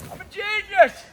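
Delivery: high triumphant cry; excited boast. Real, not acted. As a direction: crow about a clever idea. Found a bag to use.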